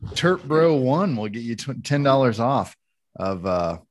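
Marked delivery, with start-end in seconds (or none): none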